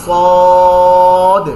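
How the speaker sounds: সদ said thick and loud with the tongue cupped; heavy sad. Shown as a demonstration. Teacher showing the thick letter sad, loud and heavy. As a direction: make সদ heavy, tongue shaped like a bowl. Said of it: "মোটা করে".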